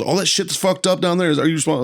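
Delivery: high, pressing interrogation; stern colonel. Retold or imitated. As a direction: grill him like a hardcore colonel.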